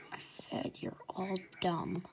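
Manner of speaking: exasperated faint whisper. Acted. Injured girl repeats whisper.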